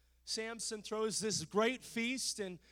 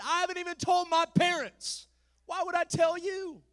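higher, exasperated retort; exasperated Samson; 9 semitones higher, 6 dB louder than usual. Acted. Samson snapping back at his nagging wife, exasperated. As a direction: brush her off, fed up.

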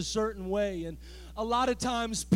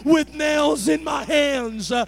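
loud, chanted preaching with huffed uh's; full-force preaching; 9 dB louder than usual. Acted. Preacher voicing Jesus speaking to Zacchaeus, chanted full-force preaching. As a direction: build it toward the cross.

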